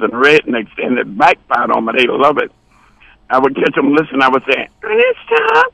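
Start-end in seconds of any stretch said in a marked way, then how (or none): 4.8-5.6 very high falsetto call; mock female falsetto. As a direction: put on a squeaky-high woman's voice. Voices woman in retold dialogue.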